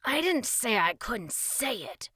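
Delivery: slow, loud retort; defiant. A narrator's reading. Boy objects to a lesson from king, defiant. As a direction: insist with stubborn stress. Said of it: "crossed his arms defiantly".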